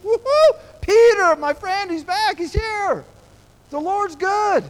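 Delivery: very high, loud joyful whoop; overjoyed shout. Retold or imitated. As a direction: Rhoda bursting with delight. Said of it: "She was excited".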